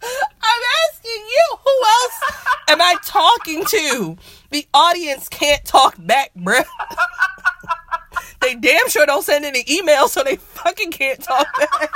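loud, high, laughing exasperation; playfully fed up, voice way up and loud; incredulous outburst. Real, not acted. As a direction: holler it in disbelief, half laughing. Incredulous at co-host's silly question.

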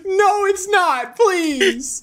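drawn-out, high-pitched plea; high, slow, mock-anguished protest; pleading denial. Real, not acted. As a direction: beg in embarrassed disbelief, voice way up. Begging friend to stop correcting him.

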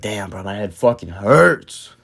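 low, drawn-out groaning complaint; retold pained realization. Telling how his head hurt once adrenaline wore off, pained. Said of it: "then the adrenaline wore off".

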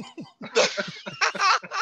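high-pitched burst of laughter; cracking up high. Real, not acted. Laughing at the dub bit.

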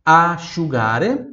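asciugare said slowly, a-shu-GA-re; slow Italian teacher model. Shown as a demonstration. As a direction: stretch asciugare for repeating. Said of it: "Repita em voz alta".

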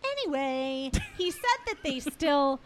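ordinary talk

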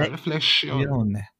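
Reflexione drawn out slowly, Italian-style final e; Italianate Reflexion. Shown as a demonstration. Joking about spelling, he says Reflexione the Italian way. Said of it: "halb französisch, halb italienisch aussprechen".

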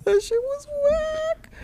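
high squeaky silly voice. Acted. Repeats line in new voice.

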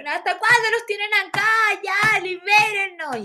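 reclamo agudo y fuerte, imitado; protesta indignada. Retold or imitated. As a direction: exigir a gritos la liberación, alargando el final. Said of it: "esa wea generó conmoción". (protesta chillada de compañera)

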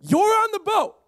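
high, loud scene-setting; loud, excited. Shown as a demonstration. Pastor pulling listeners into the story, loud and excited. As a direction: throw the audience onto the boat. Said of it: "This is where I get loud, get excited".

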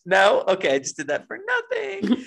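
high, loud laughing embarrassment. Real, not acted. Laughing after failed singing bit.

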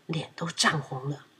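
usual speaking voice

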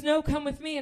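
imitated pushy insistence; insistent summons. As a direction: overrule firmly, summoning them along. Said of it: "And he goes".

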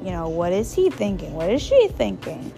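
ordinary delivery

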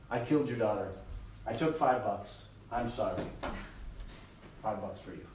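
low, soft, remorseful apology; subdued confession. Acted. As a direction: act out confessing quietly to the victim's father. Killer confesses and apologises to father.

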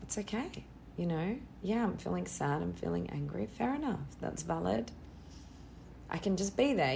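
soft, gentle reassurance to yourself; soothing self-talk. Shown as a demonstration. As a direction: quietly tell yourself it's okay. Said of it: "breathe into your body and just go".

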